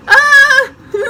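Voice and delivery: high, loud startled no; brushing off Nicholas in panic; flustered yelp. Retold or imitated. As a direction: blurt it out, caught off guard. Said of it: "And I'm just like".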